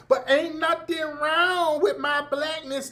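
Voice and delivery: high, drawn-out mock Southern preacher voice; mock them with a slow Southern singsong; hammed-up Southern preacher chant; fake Southern inflection. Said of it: "your fake Southern voice inflections".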